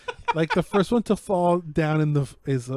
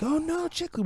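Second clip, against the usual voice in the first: mock alarm; 12 semitones higher, 6 dB quieter than usual.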